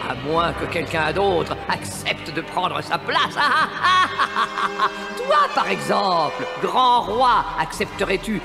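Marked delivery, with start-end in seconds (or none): 3.4-5.1 evil cackle. Acted. Sea witch gloats over king.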